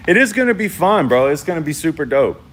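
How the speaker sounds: excited. Real, not acted. Hyped about tomorrow's guest.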